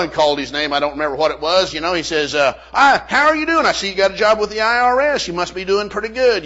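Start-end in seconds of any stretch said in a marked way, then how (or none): 2.7-6.4 hearty preparer. Retold or imitated. Tax preparer greets former student.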